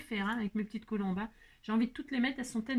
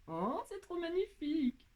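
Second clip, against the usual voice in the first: a high, slow oh of delight; delighted gush; 7 semitones higher, 4 dB quieter than usual. Real, not acted. Crafter admiring the dove she just placed, high delighted gush. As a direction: gush over how pretty it is.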